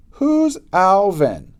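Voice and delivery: slow, high and loud taunting question; mocking sneer. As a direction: drag it out to pretend he doesn't exist. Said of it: "looked right through me".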